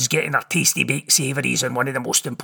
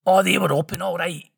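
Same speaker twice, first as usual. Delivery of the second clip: ordinary talk, nothing marked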